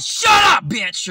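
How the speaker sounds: sudden high, loud yell; shouted comeback. Retold or imitated. Employee snapping back at his new manager, exaggerated shout. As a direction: explode on "Shut up", then drop on "bitch".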